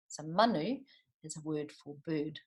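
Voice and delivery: ordinary delivery, nothing marked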